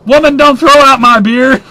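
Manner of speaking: high, loud mock yell of a drunk man; drunk husband yelling. Drunk man yells at wife over thrown-out beer, mock yell. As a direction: bellow it like a sloshed husband denied his beer.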